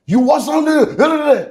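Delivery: high, slow, drawn-out imitation of a stranger; dazed bystander. Bystanders after his car crash.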